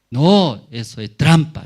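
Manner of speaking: emphatic, loud refusal; loud objection. Acted. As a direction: dismiss the report as a trick, loudly. King rejects the report.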